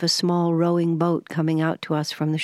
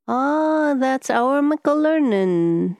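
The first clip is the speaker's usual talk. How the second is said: higher, slow Highland Scots lilt; slow Highland dialect; 7 semitones higher, 3 dB louder, slower than usual. A narrator's reading. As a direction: correct them in an unhurried Highland accent. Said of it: "soft, slow, almost unintelligible Highland dialect".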